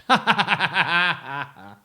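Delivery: loud happy laugh. Acted. Lydia Lou wakes and laughs, loud laugh. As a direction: burst into laughter. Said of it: "she laughed".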